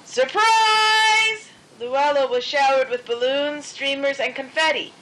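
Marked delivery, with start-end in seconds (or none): 0.1-1.5 high, loud, long-held cheer; surprise party shout. A narrator's reading. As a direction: call it out like a whole party jumping out.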